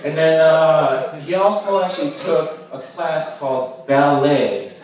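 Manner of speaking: slow teasing. Real, not acted. Slow teasing reveal, laughing.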